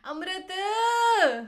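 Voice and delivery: loud, high, long-drawn name call; mom calling out. Retold or imitated. Imitating mom calling her child, drawn-out yell. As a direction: holler the name, stretching the last syllable way out. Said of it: "my mom would call me".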